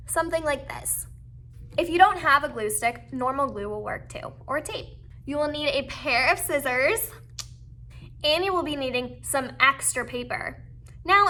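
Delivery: ordinary talk, nothing marked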